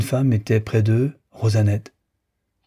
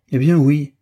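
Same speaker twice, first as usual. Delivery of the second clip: louder, higher exclamation; crying out; 5 semitones higher, 4 dB louder than usual. A narrator's reading. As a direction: burst out with the admission. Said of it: "s'écria Frédéric". (s'écrier, plus fort et plus haut)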